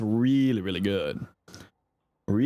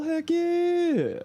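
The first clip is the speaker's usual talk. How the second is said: excited squeal; 14 semitones higher than usual. Real, not acted. Streak celebration with chat.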